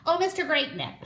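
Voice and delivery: mother bird calling to the giraffe. Acted. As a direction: call up to him hopefully. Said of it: "she called".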